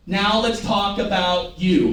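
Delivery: "Now let's talk about you" drawn out beat by beat; slow, measured "Now let's talk about you"; slow rhythmic line. Shown as a demonstration. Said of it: "Because that's the rhythm".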